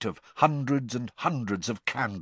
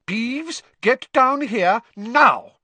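high, loud, slow stern bark; teacher ordering a poltergeist down; McGonagall barking; 7 dB louder, slower than usual. Acted. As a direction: bark each word as an order. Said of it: "barked Professor McGonagall".

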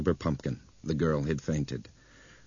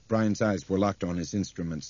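plain reading voice

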